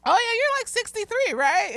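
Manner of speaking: put-on high-pitched little-boy voice; high kid voice dropping toward the end. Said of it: "student, another little boy".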